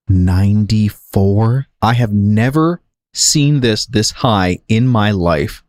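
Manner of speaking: slow, incredulous read; drawn-out disbelief. Real, not acted. Streamer seeing a huge login queue, slow disbelief. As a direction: stretch the words out in disbelief.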